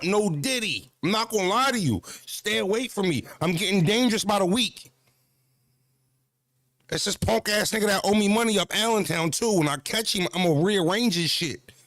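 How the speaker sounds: ordinary talk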